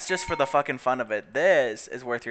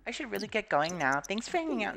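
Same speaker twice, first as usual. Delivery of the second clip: higher, soft sweet goodbye; polite sim voice; 6 semitones higher, 3 dB quieter than usual. Pretend. Voicing her sim thanking the guest, sweetly polite. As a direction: put on a sugary polite farewell.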